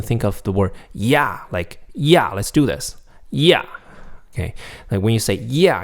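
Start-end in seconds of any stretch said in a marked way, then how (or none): 0.9-1.4 YEAH dropping in pitch; falling tone. Shown as a demonstration. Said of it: "the falling tone". 1.9-3.6 YEAH starting high and falling. Shown as a demonstration. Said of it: "your tone naturally falls". 5.3-5.8 YEAH falling from high pitch. Shown as a demonstration. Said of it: "your tone naturally falls".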